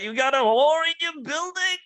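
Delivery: outraged Karen. Retold or imitated. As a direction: screech it at the supervisor. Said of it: "She's like".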